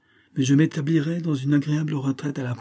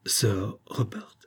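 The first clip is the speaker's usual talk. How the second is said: slow, breathless whisper of disbelief; a slow shocked whisper; stunned whisper; 5 dB quieter, much slower than usual. Acted. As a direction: barely voice the name, aghast. Stunned wife hearing the name.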